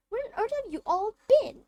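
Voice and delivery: high, loud shocked scold; indignant portrait lady. Acted. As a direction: scold the runaway children. Fat lady scolding children.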